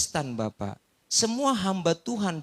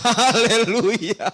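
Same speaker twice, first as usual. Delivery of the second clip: loud, slow hallelujah through laughter; laughing hallelujah; 4 semitones higher, 7 dB louder, much slower than usual. Real, not acted. Pastor relieved the lady will pay, laughing hallelujah.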